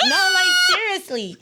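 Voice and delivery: high, loud laugh into the words; high, loud laughing insistence. Real, not acted. Laughing at own remark.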